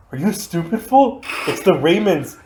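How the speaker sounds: indignant friend, voice climbing high; hammed-up high-pitched scolding of a fool; imitated fury. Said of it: "he was fucking furious".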